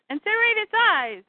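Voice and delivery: high-pitched urgent order; battle command. Acted. Harriet ordering fighters to burn the monster, urgent command.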